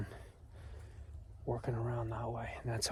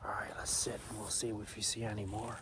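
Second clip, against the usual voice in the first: whispering; 6 dB quieter than usual. Real, not acted. Hunter whispering while waiting.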